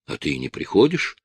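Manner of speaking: ordinary delivery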